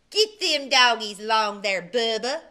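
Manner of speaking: loud bossy cowhand barking a command; bossy order. A narrator's reading. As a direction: boss Bubba around from horseback. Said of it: "ordered Dwayne".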